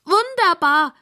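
high delighted squeal; high-pitched pig rejoicing. A narrator's reading. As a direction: squeal it like an overjoyed little pig. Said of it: "quiekt Rosamunda".

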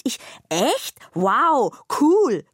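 bawling bag. A narrator's reading. Bag cheering about new technology, hollering. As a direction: holler it out delighted. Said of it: "grölt die Tasche".

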